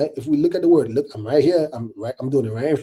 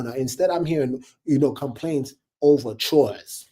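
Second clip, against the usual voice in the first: ordinary talk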